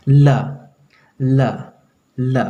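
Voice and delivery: ल repeated with tongue against the teeth; dental L. Shown as a demonstration. Teacher drills the sound for students, dental L. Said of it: "दांत में सटा करके जीभ को बोलना".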